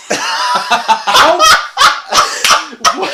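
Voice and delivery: laughing through the word. Real, not acted. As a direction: burst out laughing in disbelief. Laughing in disbelief.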